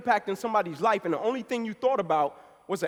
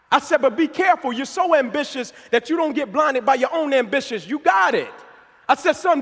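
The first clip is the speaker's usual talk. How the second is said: high, loud preacher's build; rally the crowd, preaching hard; preaching at full force; 4 semitones higher, 5 dB louder than usual. Acted. Speaker urging the audience about what matters, loud preaching.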